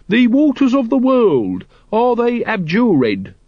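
drawn-out high-pitched incantation; intoning. A narrator's reading. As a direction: chant the ceremonial question grandly. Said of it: "intoned Brother Watchtower".

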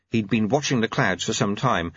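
plain reading voice

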